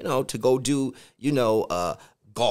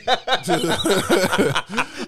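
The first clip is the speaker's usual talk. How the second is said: laughing; 6 semitones higher, 4 dB louder than usual. Real, not acted. Joking plug, laughing.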